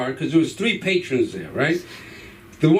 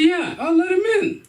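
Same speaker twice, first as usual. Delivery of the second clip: amused dad; 12 semitones higher than usual. Retold or imitated. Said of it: "He said".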